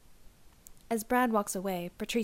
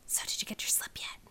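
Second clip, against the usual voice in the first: soft unvoiced whisper; 4 dB quieter than usual. Cindy asks the narrator in class, whispering. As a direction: whisper it under the teacher's nose.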